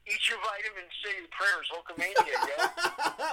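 hammed-up wrestling hero; wrestling catchphrase. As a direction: build into a high-pitched Hulkamania promo. Quotes wrestling catchphrase for the kids.

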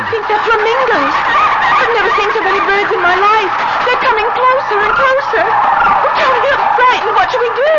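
high, loud, fast and panicky; frightened. Acted. Wife telling her husband she is frightened, panicky. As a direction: plead in rising fright.

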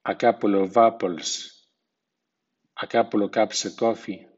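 "couple of" as "couplə" before consonants, "of" linked before vowels. Shown as a demonstration. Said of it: "el sonido V de O F se pierde".

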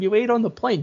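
a hint of a high mocking cop; mocking Chuck Norris, high. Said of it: "Chuck Norris is like to him".

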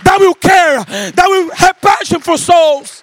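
loud, high, ringing preacher's call to the church; preaching at full force. Acted. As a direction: preach it out, full voice, singing the phrases.

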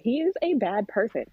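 dramatic, drawn-up disbelief; exasperated venting. Retold or imitated. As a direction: complain in mock outrage about a terrible child. Venting about a four-year-old, retold to mom.

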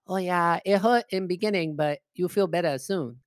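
mock Chinese accent, dropped r's; imitating Chinese-accented old lady. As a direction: reassure in a put-on Chinese accent. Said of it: "She goes".

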